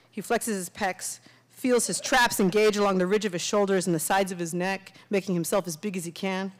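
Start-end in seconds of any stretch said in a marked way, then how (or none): none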